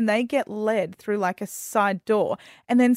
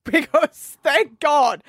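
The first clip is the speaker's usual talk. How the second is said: heartfelt relief; 4 semitones higher, 3 dB louder, much slower than usual. Real, not acted. Relief retold.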